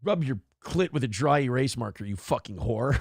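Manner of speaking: a hint of a menacing sixteen-year-old; teen boy's text. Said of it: "He's like".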